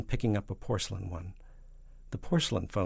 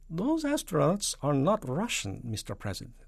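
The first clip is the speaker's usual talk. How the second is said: Soviet premier; 7 semitones higher, 4 dB louder than usual. Acted. Soviet premier answering the accusing President, emphatic.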